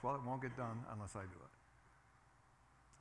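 slow, soft self-mocking voice; imitating himself. Pastor mocking his own excuse, softly. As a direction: play the stubborn pastor. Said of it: "Does that sound familiar?".

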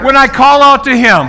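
high, loud declaration for the church to echo; preaching at full force. Real, not acted. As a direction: proclaim it boldly. Leads declaration.

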